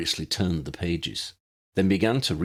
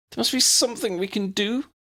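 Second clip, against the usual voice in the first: put-on higher girl's voice, pleading. A narrator's reading. Lydia pleads with the wizards, higher and pleading. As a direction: beg them like a young girl. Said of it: "Lydia pleaded".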